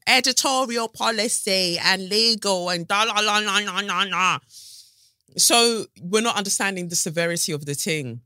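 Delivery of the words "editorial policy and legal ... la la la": high, loud mock-officious list; dismissive sing-song. Podcaster mocking news restrictions on the story, dismissive sing-song. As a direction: rattle it off mockingly into la-las. Mockingly rattles off broadcasters' excuses.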